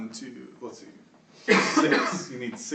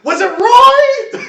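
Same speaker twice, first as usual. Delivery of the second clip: very high, slow, loud mock holler; guard's shouted question; 13 dB louder, much slower than usual. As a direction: drag the question out at full volume. Another guard in acted shouting exchange.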